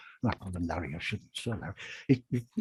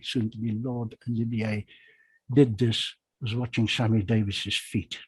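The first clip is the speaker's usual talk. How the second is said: ordinary talk, nothing marked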